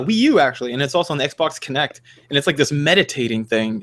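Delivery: ordinary talk, nothing marked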